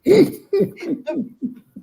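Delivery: high, loud, real burst of laughter; hearty laugh. Real, not acted. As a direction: let a big laugh roll out. Laughing after friend laughs.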